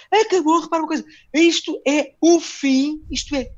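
high, loud frantic rant; unhinged rant. Retold or imitated. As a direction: rant like you've completely lost it. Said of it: "o gajo completamente passado".

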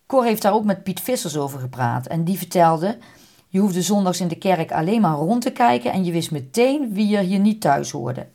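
plain reading voice

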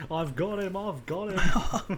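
mock triumphant yell, pitched high; imitated gleeful scream. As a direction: holler it, dragging out the last repeat. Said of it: "saying, screaming".

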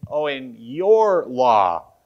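slow sarcastic quote leaning on "your". Acted. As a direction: draw it out with a sarcastic edge. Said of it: "sarcastically saying".

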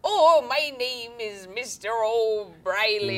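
slow, put-on Irish accent. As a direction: drag it out in a mock Irish brogue. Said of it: "or does an Irish accent".

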